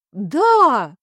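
loud, anxious yes; flustered. A narrator's reading. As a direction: blurt it out nervously. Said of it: "заволновался тут же лорд Фрейзинг".

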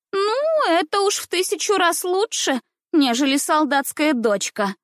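put-on high, haughty little girl; disdainful drawl. A narrator's reading. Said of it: "протянула, презрительно сморщив носик". (презрительно, тонким тягучим голоском)

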